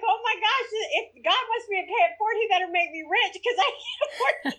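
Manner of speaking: laughing through tears. Retold or imitated. Retelling the drive-thru moment, high and laughing through tears. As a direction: squeal it out through happy tears. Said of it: "I'm crying".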